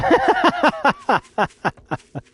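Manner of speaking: loud, high-pitched laugh. Real, not acted. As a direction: break into a high giggle. Laughs at own moonshine joke.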